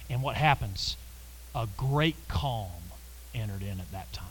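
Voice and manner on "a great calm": ordinary delivery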